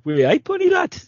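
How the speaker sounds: high-pitched Geordie catchphrase voice; put-on Geordie accent. Shown as a demonstration. Podcaster showing off accents learned from Auf Wiedersehen, Pet, high put-on Geordie. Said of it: "I'm not bad at accent".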